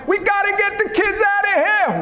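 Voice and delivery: high, hammed-up urgent complaint; agitated disciple, acted out; disciple urging a buddy. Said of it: "They said like this".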